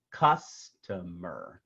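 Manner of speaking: "customer" drawn out slowly, CUS-tuh-mer; slow, separated syllables of "customer", leaning on the first; stress on first syllable. Shown as a demonstration. Teacher gives a second downbeat example, "customer" slowly stressed at the start. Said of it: "the stress is on the first syllable".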